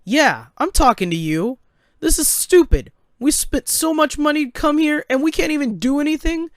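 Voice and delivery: high, loud indignant complaint; angry protest. Acted. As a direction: have it out with the guard, voice climbing sharply. Angry man confronts the guard.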